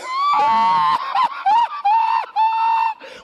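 high shrieking laugh. Real, not acted. Preacher laughs at congregation.

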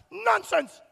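mock dismissive "nonsense", pitched high; mocking disbelief. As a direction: scoff it up high. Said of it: "and they said".

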